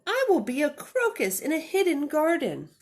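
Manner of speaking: high, loud little bunny. Acted. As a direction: play the runaway bunny, bright and eager. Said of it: "said the little bunny".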